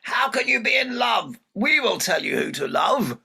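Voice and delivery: high, loud furious shout; mother shouting angrily at her daughter. Acted. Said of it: "Sun got really angry".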